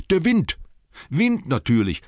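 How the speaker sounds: high, loud excited hobbit; triumphant hobbit. Acted. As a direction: cry out the answer. Bilbo answers triumphantly.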